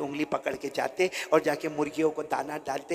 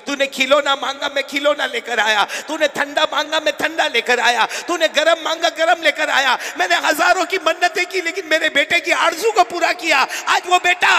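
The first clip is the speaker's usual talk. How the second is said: high, loud grieving cry; father's lament; 9 semitones higher, 6 dB louder than usual. Retold or imitated. Old father crying out to his neglected son. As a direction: pour out the father's hurt.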